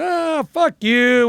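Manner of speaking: high, drawn-out mock jeer; disbelieving friends. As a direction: imitate buddies scoffing down the phone. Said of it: "I can hear my friends going".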